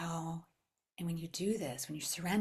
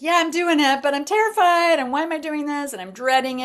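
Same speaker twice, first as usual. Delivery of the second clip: much higher and louder, hammed-up panic; mock terrified; 11 semitones higher, 15 dB louder than usual. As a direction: squeal the fear out, half joking. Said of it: "I was like".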